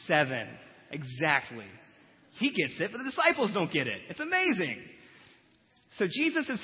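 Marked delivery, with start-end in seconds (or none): 0.1-0.5 loud low emphatic seven; loud firm answer; emphatic repeat. Acted. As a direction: land the number hard.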